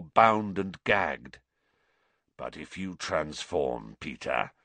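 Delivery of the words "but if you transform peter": low, soft threat; growl. A narrator's reading. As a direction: growl a quiet warning.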